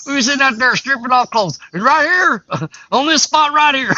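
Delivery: high, loud, laughing retelling; excited storytelling. Real, not acted. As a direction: tell it big and loud, cracking up. Acting out a comic scene, loud.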